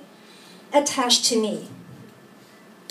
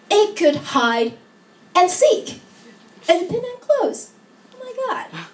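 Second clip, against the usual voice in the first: high, rising wonder, phrase by phrase; awed delight; 6 semitones higher than usual. Acted. Woman marvelling at her own body, in rising delight. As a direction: build in pitch to an amazed peak.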